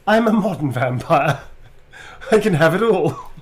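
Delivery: high, loud, through laughter; laughing. Pretend. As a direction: announce it grandly and crack up. Joking quote while laughing.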